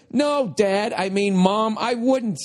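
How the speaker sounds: put-on high-pitched refusal in a child's role; mock appalled kid.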